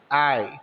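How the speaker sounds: I said like feeling real pain; I like a pained cry. Shown as a demonstration. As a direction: groan I as if hurt. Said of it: "como se estivesse sentindo uma dor mesmo". (I como se sentisse dor)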